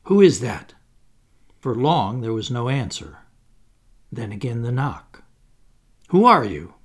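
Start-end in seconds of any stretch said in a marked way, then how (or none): 0.0-0.7 louder challenge to an unseen visitor; calling out. A narrator's reading. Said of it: "Then he spoke". 6.1-6.7 louder, insistent challenge; calling out. A narrator's reading. Challenging unknown knocker at door.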